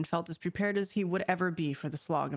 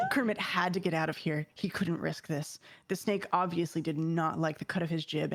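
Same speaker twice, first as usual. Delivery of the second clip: plain reading voice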